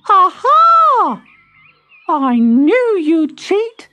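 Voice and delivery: put-on the baby pops out and catches the cheats; Baby Tortoise catching them. A narrator's reading. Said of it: "a little voice said".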